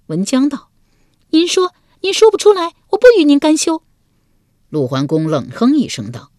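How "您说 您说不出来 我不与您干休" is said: high, loud, shrill dare; a furious wife daring him to speak; defiant challenge. A narrator's reading. Wife daring her husband to say it, high and loud.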